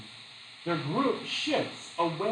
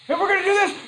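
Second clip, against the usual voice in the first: much higher and louder mock campaign yell; Howard Dean scream; 9 dB louder, faster than usual. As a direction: ham up an over-the-top rally scream. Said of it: "he just goes cray cray on T V".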